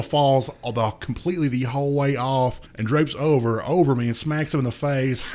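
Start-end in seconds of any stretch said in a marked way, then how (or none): none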